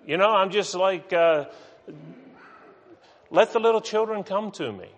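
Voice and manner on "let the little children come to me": breezy, higher-pitched and carefree; happy-go-lucky. Acted. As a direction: read it lightly, as if fine with everything. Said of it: "just kinda happy-go-lucky".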